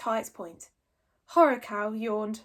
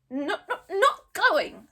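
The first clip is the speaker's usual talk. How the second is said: high stuttering stubborn little dragon; 8 semitones higher, 7 dB louder, much slower than usual. Acted. Toothless refusing to let go, stubborn stutter. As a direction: refuse it, stammering. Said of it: "said Toothless stubbornly".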